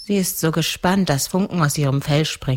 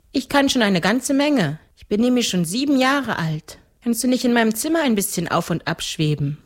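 put-on higher little-girl voice; seven-year-old girl; 7 semitones higher than usual. (kindliche Mädchenstimme)

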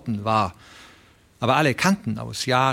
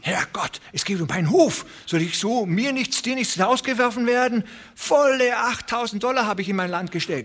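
much higher, near-wailing protest; desperate old rancher; 11 semitones higher than usual. Acted. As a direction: plead for the farm in panic.

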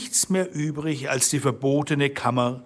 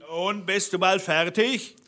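raised-pitch impatient call; Bluebeard calling up; 7 semitones higher than usual. A narrator's reading. Bluebeard from below to his wife, impatient call. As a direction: call up impatiently, without much volume. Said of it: "rief der Blaubart unten".

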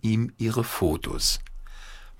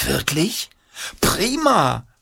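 excited; 9 semitones higher, slower than usual. A narrator's reading. Paul thrilled by no school.

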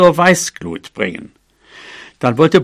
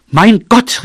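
higher, louder outburst; exasperated cry; 5 semitones higher, 5 dB louder than usual. A narrator's reading. Mouse whose kisses go unfelt, exasperated outcry. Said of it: "rief sie".

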